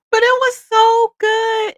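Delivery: high, loud, slow and drawn out; gushing excitement. Real, not acted. Telling viewers about the fried chicken dinner, loud gushing rave. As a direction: savor every word like the food.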